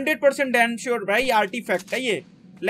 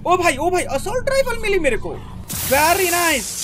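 delight at the loot; excited; 6 semitones higher than usual. Real, not acted. Finding an assault rifle. (बहुत उत्साह से ऊँची आवाज़ में)

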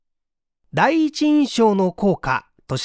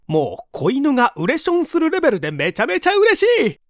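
overjoyed gush; 6 semitones higher than usual. Acted. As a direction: gush like an overexcited puppy. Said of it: "最後の最後でビシッと決まらなくて".

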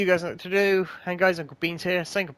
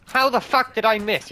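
louder, higher angry yell at the game; furious shout; 8 semitones higher, 7 dB louder than usual. Real, not acted. Gamer yelling at the game after missing, furious shout. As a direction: blow up over a missed shot.

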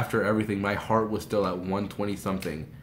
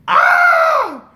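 very high, loud fan scream; freak-out scream; 16 dB louder than usual. Retold or imitated. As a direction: scream with uncontainable thrill. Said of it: "I was freaking out".

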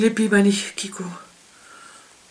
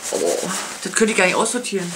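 usual speaking voice

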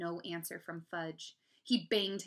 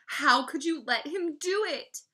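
loud, high, slow reproach; accusing; 8 semitones higher, 11 dB louder than usual. A narrator's reading. Brother blames Mom for letting Fudge do it, accusing. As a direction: hit each word in outrage.

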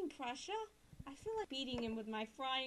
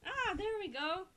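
higher, squealing excitement; fangirl excitement; 8 semitones higher, 4 dB louder than usual. Real, not acted. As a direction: gush like a fangirl. Fangirl reaction.

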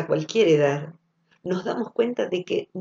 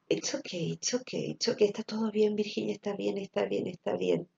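soft, much quieter reassurance; soothing self-talk; 9 dB quieter than usual. Retold or imitated. Patient before surgery calming herself, softly soothing. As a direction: hush yourself gently before the operation.

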